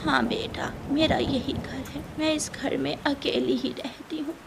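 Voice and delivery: put-on quiet, gentle old bird; a lonely old mother answering softly; hint of a frail old mother living alone.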